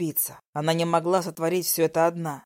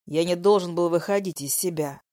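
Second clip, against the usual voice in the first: ordinary talk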